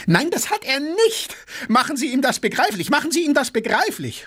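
very high, frantic protest; shrieking demand, racing through the repeats; furious cry. A narrator's reading. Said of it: "schrie Gaganow". (wütend schreiend)

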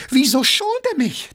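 high-pitched outraged question; raging. A narrator's reading. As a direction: explode at being spared. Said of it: "tobte Gaganow". (tobend vor Wut)

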